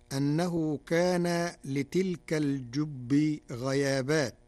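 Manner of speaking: ordinary delivery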